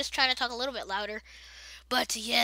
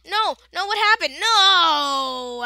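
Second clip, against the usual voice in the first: louder, higher cries of no; dismayed yell; 4 semitones higher, 9 dB louder, much slower than usual. Real, not acted. Dies in game.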